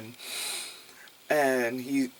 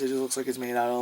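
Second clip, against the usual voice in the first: ordinary talk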